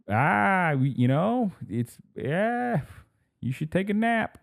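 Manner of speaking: hesitant, high-pitched husband fumbling for advice; mock helpless suggestion.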